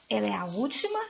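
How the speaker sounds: normal talking voice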